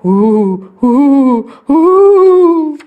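ghostly ooh. A narrator's reading. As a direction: imitate a ghost, building each time. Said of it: "It went".

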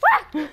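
short, high, loud yelp of fright; real jump-scare shriek; startled scream. Real, not acted. Gamer startled by an enemy, frightened yelp. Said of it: "That was scary. Very, very scary".

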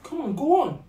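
high, loud encouragement; insistent, coaxing push to go ahead; urging on. Acted. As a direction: egg a reluctant friend on. Urging reluctant friend to speak.

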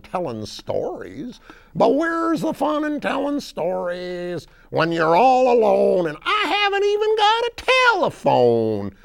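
high, loud, rustic country bear; Bettina. Acted. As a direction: hoot and holler like a backwoods gal. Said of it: "she goes out and she says".

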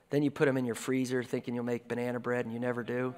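normal talking voice

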